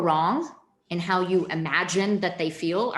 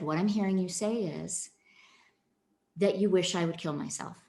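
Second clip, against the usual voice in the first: soothing validation, softer; calm demo; 3 semitones lower, 4 dB quieter than usual. Shown as a demonstration. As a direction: breathe and answer gently. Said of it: "I took a deep breath".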